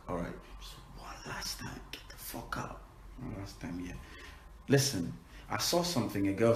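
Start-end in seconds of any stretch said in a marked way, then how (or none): 0.1-1.8 hushed, unvoiced-breath whisper; sneaky whisper. Real, not acted. Asking viewers for one last swear, whispered. 1.9-2.8 very soft, whispered curse; sneaky whisper. Real, not acted. Told not to swear, sneaks it in whispered. 3.2-3.9 very soft, lower-volume aside; hushed excuse. Real, not acted. Excusing one last swear to viewers, hushed.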